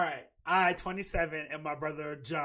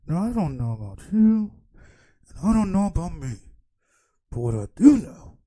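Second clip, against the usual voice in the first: loud, put-on sassy relative voice; family voicing judgement at dinner, loud hammed-up sass; mock family member; 11 dB louder than usual. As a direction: ham up a judging auntie. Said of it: "we can be like".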